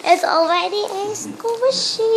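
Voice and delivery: gasps, then high and delighted; sudden surprised gasp, pitch up. Real, not acted. Sees finished squishy.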